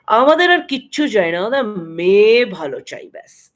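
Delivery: mimicked visiting in-laws. Visitors choosing a bride for their family, loud hammed-up imitation. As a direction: proclaim it like a self-satisfied matchmaking guest. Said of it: "This kind of thing they used to say".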